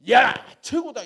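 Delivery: loud, delighted high cry; excited exclaim. Retold or imitated. Pastor thrilled at the cleared land, loud delighted cry. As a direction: shout out the pastor's joy over the land. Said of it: "이렇게 하셨어요".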